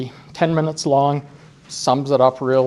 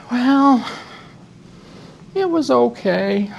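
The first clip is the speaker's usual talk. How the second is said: slow, higher drawn-out voice; Mike's slow reply; 8 semitones higher, slower than usual. Retold or imitated. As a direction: drag it out like Mike.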